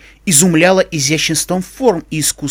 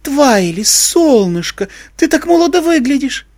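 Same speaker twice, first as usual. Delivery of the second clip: slow, higher, affected society-lady voice; affected grande dame; 8 semitones higher, slower than usual. A narrator's reading. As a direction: gush it like a pampered diva. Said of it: "жеманно произнесла Рарити".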